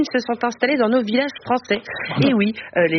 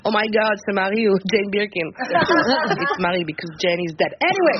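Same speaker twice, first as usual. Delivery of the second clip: put-on English accent; 2 semitones lower than usual. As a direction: play a Brit long settled in France, English accent. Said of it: "j'imite vraiment mieux l'accent anglais".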